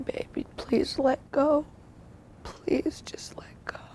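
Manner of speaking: soft tearful whisper; tender whisper. Retold or imitated. Wife releasing her dying husband, tender whisper. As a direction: whisper it gently, letting him go.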